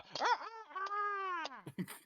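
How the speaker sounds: long high cock-a-doodle crow; rooster crowing. Acted. Knight continues crowing at the monster, long high rooster call. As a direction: crow high, letting it drop at the end. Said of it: "cawing and crowing".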